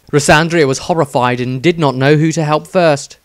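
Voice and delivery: ordinary delivery, nothing marked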